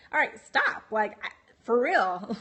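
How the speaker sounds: insistent retold "enough now"; retold firm protest. Retold struggle to get out.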